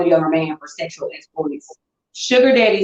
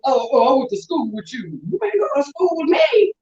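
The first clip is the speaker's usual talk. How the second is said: higher, exasperated mock quote; mock retelling; 5 semitones higher than usual. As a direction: act out his clumsy line, then protest. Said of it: "told us".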